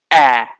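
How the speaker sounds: short open a as in bat, said clearly on its own; short a sound. Shown as a demonstration. Teacher modelling the sound of first place light dot, clear vowel. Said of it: "वो sound देता है".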